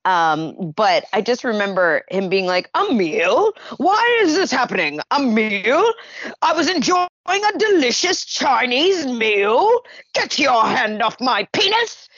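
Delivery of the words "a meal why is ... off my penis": high, indignant fake British accent. Actor being arrested protests in fake British accent. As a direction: splutter in a put-on British accent at the police. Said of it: "he put on this fake British accent".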